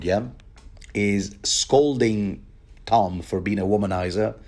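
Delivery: ordinary talk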